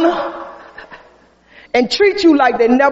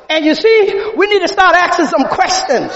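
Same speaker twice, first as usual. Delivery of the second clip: normal talking voice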